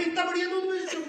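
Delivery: high mock-sweet repeat of the rich man's praise; mocking flattery. Teller mocks the stranger's flattery, high hammed-up repeat. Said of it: "और बोले".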